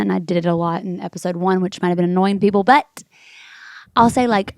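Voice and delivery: normal talking voice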